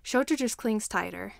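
usual speaking voice

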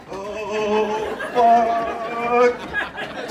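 long hollered oh-no reaction; alarmed groan. Retold or imitated. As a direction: stretch out each word in comic dread. Reliving alarm up in climber.

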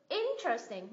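'IN-teresting', the rest falling away; stress first syllable. Shown as a demonstration. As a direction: lean on the first beat of interesting. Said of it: "mình nhấn tiếp thứ nhất".

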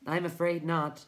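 high, chirping little voice; chirped. Acted. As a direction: chirp the answer lightly. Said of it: "chirped the Tiny".

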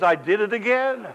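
high, loud, caught-out giggle; sheepish admission. Retold or imitated. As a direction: own up, amused at herself. She laughs at her own objection.

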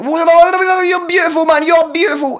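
high-pitched gushing babble; acted-out starstruck wonder; ecstatic awe. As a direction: gush in a squeal at something dazzling. Acting out awe at a star.

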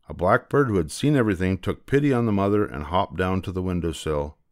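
plain reading voice

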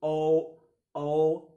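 أو said loud as the letter name; teacher drilling the long o; long o demo. Shown as a demonstration. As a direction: call out the letter name o clearly. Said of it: "هنطق الأو باسمه".

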